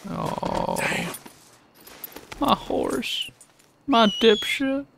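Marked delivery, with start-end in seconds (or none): 2.4-4.9 mock wailing. Real, not acted. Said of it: "I'm genuinely so upset right now".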